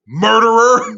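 high, loud teasing shout; buddy heckling the manager mid-apology, loud mock shout; yelled accusation. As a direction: bellow it right in his face. Big Jim yelling in his face.

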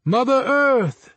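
high, slow ringing call; calling out loud. A narrator's reading. Anansi summoning Mother Earth, high slow call. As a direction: summon the earth goddess, drawn out. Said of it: "Anansi called out".

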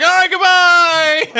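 high, slow, drawn-out goodbye; mock hasty goodbye. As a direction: sing it out as you bolt. Leo fleeing, high and slow.

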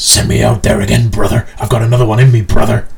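loud, brash wrestler promo voice; pumped-up wrestler shouting at the crowd; Hulk Hogan impression. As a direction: bellow it like a wrestling promo, brother. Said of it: "He just goes up to Bischoff and just says".

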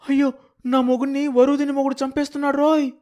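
a woman wailing for her husband; wailing wife. Acted. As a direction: shriek it out, beating her head. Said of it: "ఏడుస్తోంది".

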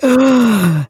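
sharp loud gasp of surprise; mock shocked gasp. Friend retelling the play, gasping in mock shock. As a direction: gasp like the twist just landed. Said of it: "I was like".

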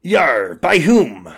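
pirate talk breaking into a laugh; pirate diplomat. Acted. Pirate corrects grammar aboard ship, pirate voice. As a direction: play a sea dog proud of grammar.

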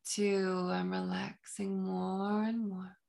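soft, slow hypnotic induction; slow hypnotist voice. Acted. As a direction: lull them down gently and slowly. Hypnotic countdown continues.

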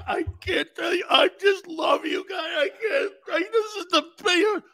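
high wailing sob-talk, imitating a weeping coach; mock crying. Coach sobbing to his players before the big game, hammed-up. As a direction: blubber it out like a coach choking up. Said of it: "He's crying like this".

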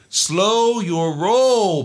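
very slow, loud, high drawn-out warning; friend's catchphrase. Retold or imitated. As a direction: stretch it out to calm him down. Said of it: "which is his way of saying".

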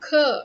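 k as a loud lone 'kuh'; isolated sound demo. Shown as a demonstration. Teacher building the word sound by sound, loud isolated 'kuh'. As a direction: punch out k with a short 'uh'. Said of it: "if you can say".

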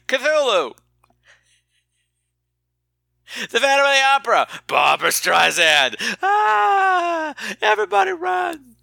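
hammed-up horror movie scream; mock panic. Mock monsters fleeing panic.

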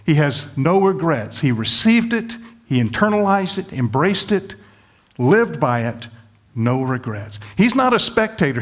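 normal talking voice

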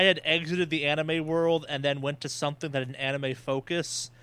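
ordinary talk, nothing marked

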